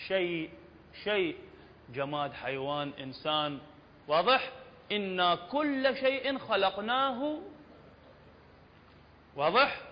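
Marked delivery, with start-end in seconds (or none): 4.9-7.4 slow, raised verse recitation; measured tajweed delivery, held open at the end; Quran recitation. Shown as a demonstration. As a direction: recite with drawn vowels, pitch lifted.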